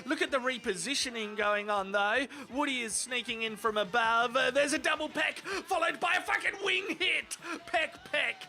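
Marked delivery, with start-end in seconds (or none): 4.4-8.4 high-pitched fight commentary; worked-up ringside caller; excited play-by-play. Pretend. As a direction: call the blows as they land, pitch climbing. Play-by-play of rapid pecks.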